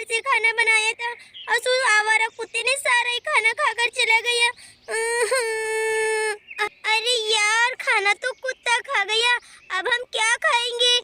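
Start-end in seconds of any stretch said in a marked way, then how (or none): 4.9-6.8 mother sobbing. Acted. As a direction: cry your heart out, sobbing. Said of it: "और यहाँ पर अंजलि रोने लगती है।".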